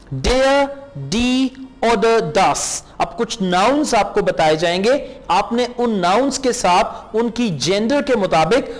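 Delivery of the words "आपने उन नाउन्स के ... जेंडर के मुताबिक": ordinary delivery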